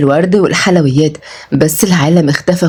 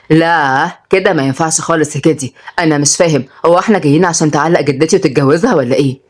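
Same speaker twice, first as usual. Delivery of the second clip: ordinary delivery, nothing marked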